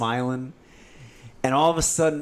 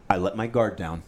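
normal talking voice

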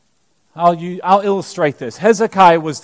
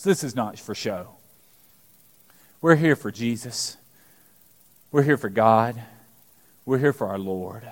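hushed conviction; 7 semitones lower, 8 dB quieter than usual. Real, not acted. Preacher reminding congregation why they worship, hushed. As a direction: say it quietly, like a vow.

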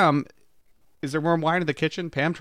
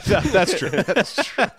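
laughing; 6 semitones higher, 5 dB louder than usual. Real, not acted. Laughing agreement.

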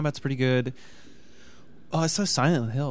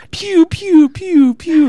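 laser noises; 11 dB louder, much slower than usual. Retold or imitated. Imitating laser sounds.

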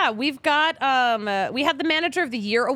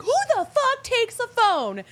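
much higher, exasperated disbelief; exasperated outburst; 12 semitones higher than usual. Retold or imitated. Host retelling her reaction to her stolen phone, higher exasperated disbelief. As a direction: toss off an outraged question in a raised pitch. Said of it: "I was like".

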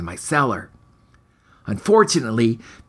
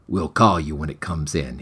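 bored librarian; 11 semitones lower than usual. Acted. Librarian dismisses the hero.

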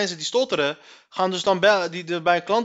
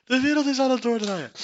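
high squeal at normal volume, falling; hilarische verzuchting; 6 semitones higher than usual. Real, not acted. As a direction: repeat it as a spent, high-pitched wail. Repeats it, winding down from laughter. (hoge verzuchting na het lachen)

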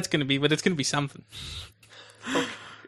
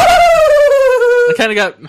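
mock shout; 11 dB louder, much slower than usual. Mock-shouts own phrase.

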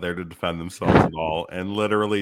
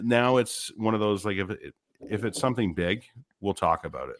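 normal talking voice

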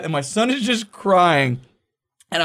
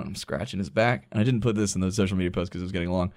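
normal talking voice

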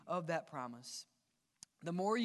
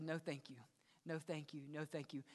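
quiet refusal; 5 semitones lower, 10 dB quieter than usual. Acted. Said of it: "And you've said".

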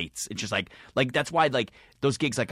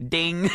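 microwave ding imitation; 6 semitones higher than usual.

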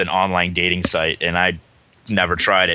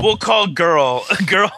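laughing; 10 semitones higher, slower than usual. Real, not acted. Laughing at the name joke.

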